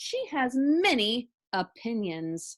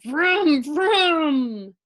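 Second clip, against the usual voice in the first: snoring vroom; 3 semitones higher, 8 dB louder than usual. A narrator's reading. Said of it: "Mister Watson said".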